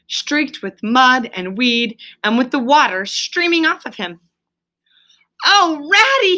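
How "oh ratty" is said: high, loud cry of relief. A narrator's reading. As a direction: Toad bursts out with relief. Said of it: "he cried".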